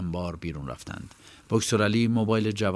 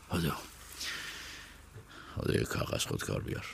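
hushed order; 2 semitones lower, 9 dB quieter than usual. Acted. Boksor Ali orders quietly.

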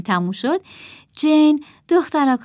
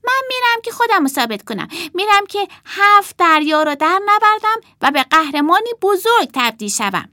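much higher, fast proud shout; boy shouting; 11 semitones higher, 6 dB louder than usual. Acted. Puppet boy shouts his farewell, fast and proud. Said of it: "از زبان پسرک با فریاد گفت".

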